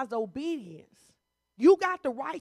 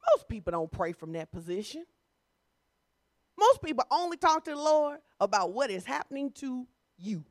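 normal talking voice

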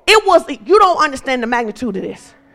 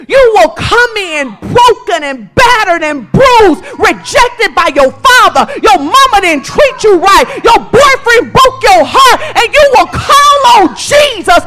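high, loud, driving sermon cadence; full-force preaching; 7 semitones higher, 5 dB louder than usual. Real, not acted. Preacher promising the broken healing, rising fervour. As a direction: drive each phrase home to the congregation.

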